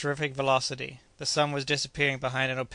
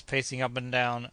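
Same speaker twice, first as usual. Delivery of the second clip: usual speaking voice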